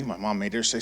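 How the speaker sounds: ordinary delivery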